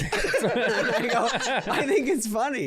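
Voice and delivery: laughing as he says it. Real, not acted. Laughing at his own story.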